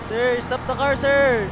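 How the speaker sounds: high, loud yell across a distance; loud desperate call after the car; shouting after a car. Acted. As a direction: bellow to make the driver stop.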